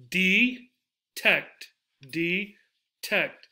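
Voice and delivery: detect in two beats, "dee ... tect"; detect, slowly pulled apart syllable by syllable; split into syllables. Shown as a demonstration. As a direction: clear pause inside detect. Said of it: "Where are they?".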